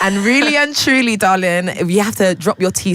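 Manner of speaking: lightly put-on royal English accent; posh British accent. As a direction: lecture like a posh Londoner, darling. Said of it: "royal English".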